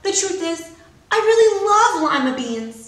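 high loud admission; a girl's high eager confession; blurted confession. A narrator's reading. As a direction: burst out the truth. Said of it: "she cried".

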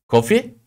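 "Coffee." rising high, eyebrows up. Shown as a demonstration. Teacher showing students facial expression, rising "Coffee.". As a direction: ask "Coffee." with lifted brows. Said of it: "kaşlarımı kaldırıp onu gösteriyorum".

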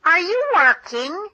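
a hint of a small fly asking; fly's voice. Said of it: "says the fly to the spider".

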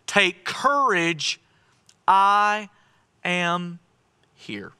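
slow, deliberate, each word set apart; calm them word by word; reassuring Jesus. Acted. Jesus speaking to frightened disciples, slow and reassuring. Jesus's words, slowed and spaced.

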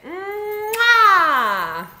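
loud, high, drawn-out "mwah"; big blown kiss. Shown as a demonstration. Leader blowing children a kiss, loud mwah. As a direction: throw out an exaggerated smooch sound.

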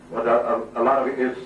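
ordinary talk, nothing marked

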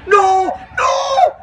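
very high, squealed panicky shout; shouting dragon. A narrator's reading. Dragon tries to stop the station master, high panicked shout. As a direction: cry out in a loud, piercing creature voice. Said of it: "shouted the dragon".

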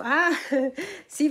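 ordinary delivery

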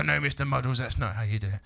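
a hint of a patient presenter correcting; mild mock kids' TV telling-off. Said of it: "they go".

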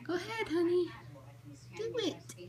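high, soft pet coaxing. Real, not acted. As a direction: gently coax the bird on. Coaxing the bird softly.

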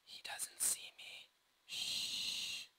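slow whisper while sneaking; whispered sneaking, then a shush; sneaky whisper. Pretend. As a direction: hush it like hiding from a guard. Sneaking past enemy in game.

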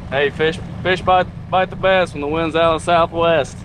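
ordinary delivery

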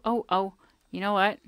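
ordinary talk, nothing marked

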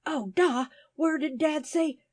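high-pitched desperate appeal; acted panic, high and urgent. A narrator's reading. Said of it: "cried Nell rather desperately".

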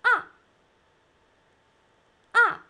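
"Ah" short, falling sharply from high; sharp, loud falling "Ah"; fourth tone. Shown as a demonstration. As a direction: drop each "Ah" fast as if hurt. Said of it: "sharp falling like you're hurt".

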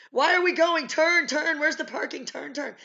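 high, loud panicked backseat questions; freaking out. Acted. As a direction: act out a dog in a panic. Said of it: "Now I'm freaking out".

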